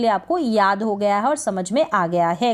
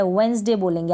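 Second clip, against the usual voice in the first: ordinary delivery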